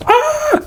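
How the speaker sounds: high-pitched squeal of delight; excited squeal. Real, not acted. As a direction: squeal with joy at the box. Squeal on seeing Hermes box.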